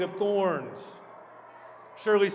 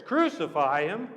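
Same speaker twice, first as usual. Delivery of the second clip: disbelieving question with a rising end; incredulous. Acted. Pilate echoes the crowd's demand, incredulous. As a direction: ask it as if you can't believe it. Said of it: "Pilate couldn't believe his ears".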